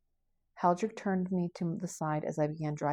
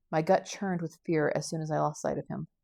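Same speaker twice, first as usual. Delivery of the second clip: plain reading voice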